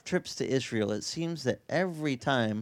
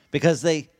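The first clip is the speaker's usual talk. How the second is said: ordinary talk, nothing marked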